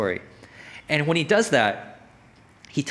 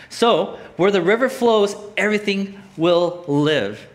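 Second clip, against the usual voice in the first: slow, emphatic proclamation; scripture reading; 3 semitones higher, 4 dB louder, slower than usual. Acted. Preacher reading that the river brings life, slow emphatic scripture reading. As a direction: land each word of the promise.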